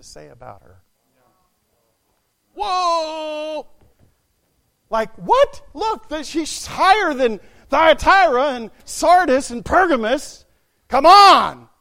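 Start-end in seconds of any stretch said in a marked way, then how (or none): 2.5-3.7 high, long-held exclamation; astonished. Acted. Acted astonishment at no praise. 4.9-11.7 loud, raised-pitch incredulous appeal; exasperated, shouted objection; indignant protest. Acted. Acted indignant protest at the verdict.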